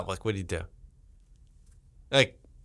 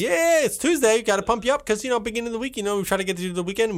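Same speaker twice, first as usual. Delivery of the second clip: high, pumped-up host voice; hype host; 9 semitones higher, faster than usual. Acted. Host hyping the audience on Tuesday. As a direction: pump the listeners up.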